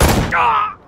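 sudden loud high scream of pain. Acted. Villain hit by Conway, loud pained scream. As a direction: scream as the blow lands.

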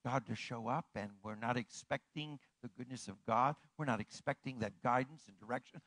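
ordinary delivery

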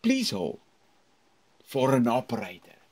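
pause, then announce it; phone announcement. Shown as a demonstration. Teacher demonstrating the hold message again. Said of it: "it sounds very nice if you do this".

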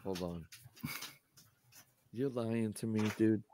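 faint, distant voice; far from mic. Real, not acted. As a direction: off mic and quiet. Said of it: "you're quiet".

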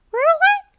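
very high, loud gasp; gasping really. A narrator's reading. The girls gasp at learning they won, high and loud. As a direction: gasp in delighted surprise. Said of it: "the girls gasp".

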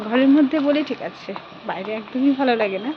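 soft, shy voice, kept low in the street; speaking softly. Real, not acted. A shy mother walking past neighbours, speaking softly. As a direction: keep it soft so the neighbours can't hear. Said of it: "আস্তে আস্তে বলছি তো".

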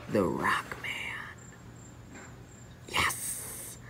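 soft, secret plotting whisper; sneaky whispered plan; scheming whisper. Acted. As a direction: whisper the plan so nobody hears. Scheming a trick privately.

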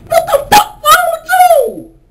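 high, loud incredulous yell; high shrieking what is wrong with you; exasperated yell. Acted. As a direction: scream at the fool in disbelief. Mocking the dumb robber.

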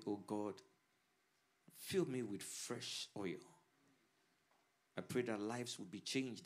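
soft, hushed opening prayer. Real, not acted. Pastor praying softly before the sermon, hushed prayer. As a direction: pray quietly over the congregation before preaching.

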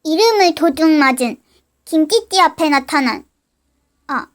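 low cliffhanger narration; narrator voice. A narrator's reading. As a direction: close the episode like a narrator.

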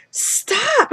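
high yelp, half mock, half real; panicked stop. Mom yelps at her son to stop unboxing, high panicked cry. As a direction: yelp to halt him at once. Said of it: "my O C D's kicking in".

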